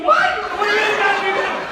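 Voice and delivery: high, loud, fast disbelief; shocked protest. Retold or imitated. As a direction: sputter in outraged surprise. David protesting another take.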